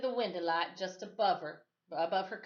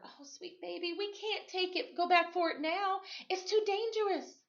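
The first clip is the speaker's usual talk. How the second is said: tender whisper; 11 semitones higher than usual. A narrator's reading. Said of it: "he whispered as he hugged her".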